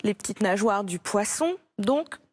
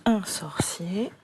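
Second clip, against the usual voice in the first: whisper; 4 semitones lower, 5 dB quieter than usual. Shown as a demonstration. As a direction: whisper it to the pupil. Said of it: "whispered dictation aside".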